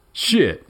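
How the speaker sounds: sudden high pained yelp; startled cry of pain. Acted. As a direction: yelp it out, caught off guard. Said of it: "he yelped".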